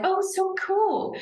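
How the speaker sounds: high, slow, gushing imitation; mock impressed. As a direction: parody people gushing over her dish. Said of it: "People are like".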